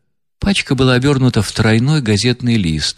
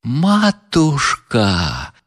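long drawn-out wail, voice pitched higher; a mild wail of despair, voiced in another timbre; wailing cry; 6 semitones higher, much slower than usual. A narrator's reading. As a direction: cry out in despair, dragging the word. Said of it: "всё ещё вопил Лебедев".

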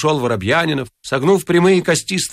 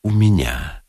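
very low, small answer; low sheepish reply; cornered confession; 8 semitones lower, slower than usual. A narrator's reading. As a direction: admit it miserably.

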